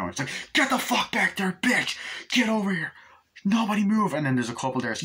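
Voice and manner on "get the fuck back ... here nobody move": robber yelling. Retold or imitated. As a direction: scream commands at the hostages. Robbers take over diner.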